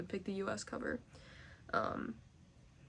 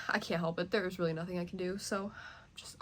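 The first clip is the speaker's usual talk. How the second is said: ordinary delivery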